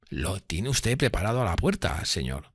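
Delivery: soft, deferential servant's reply; bow and answer quietly; deferential waiter. A narrator's reading.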